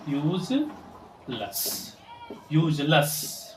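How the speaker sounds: "यूजलेस" stretched out as "yooj-luss"; correct pronunciation. Shown as a demonstration. Teacher modelling for learners, "यूजलेस" as "yooj-luss". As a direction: schwa ending "luss" in "यूजलेस". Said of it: "इसे यूजलेस पढ़ते हैं".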